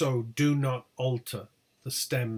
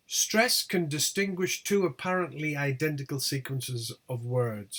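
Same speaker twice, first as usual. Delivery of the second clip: normal talking voice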